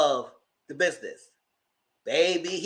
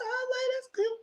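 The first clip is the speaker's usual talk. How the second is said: high falsetto, softer and set apart from her own voice; mock chirpy approval; 7 dB quieter than usual. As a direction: float it up high and easy. Retelling own reaction to news.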